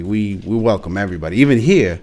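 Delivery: normal talking voice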